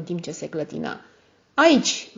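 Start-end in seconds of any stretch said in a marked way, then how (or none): 1.5-2.1 high, loud, testy outburst; cantankerous. A narrator's reading. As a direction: throw the word out irritably, pitched up. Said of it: "zise el arțăgos".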